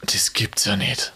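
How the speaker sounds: slow, breathless whisper of disbelief; stunned whisper. Real, not acted. Ungläubig über KI-Antwort. (geflüstertes, fassungsloses Staunen)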